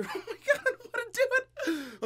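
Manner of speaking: laughing nervously, voice jumping high; giggling out of pure dread; nervous laughter. Real, not acted. Dreading eating the pepper.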